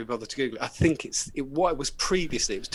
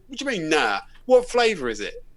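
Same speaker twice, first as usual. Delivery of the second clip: loud, higher demanding customer; angry customer; 3 semitones higher, 7 dB louder than usual. Retold or imitated. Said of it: "And he went".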